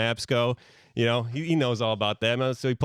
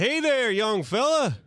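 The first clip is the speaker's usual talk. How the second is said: put-on folksy old man greeting; 12 semitones higher than usual. Stranger greets Duke on the road.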